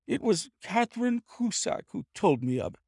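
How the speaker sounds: crackling voice. A narrator's reading. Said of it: "said he in a crackling voice".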